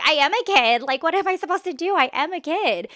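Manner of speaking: high, loud, rapid mock protest; indignant child retort. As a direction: protest like an exasperated kid talking back. Acts out child retorting to parents.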